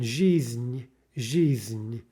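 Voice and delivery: "жизнь" with a slight extra beat on N; sounds like two syllables. Shown as a demonstration. Teacher showing the extra syllable, zhi-zn in two. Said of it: "sounds like two sometimes".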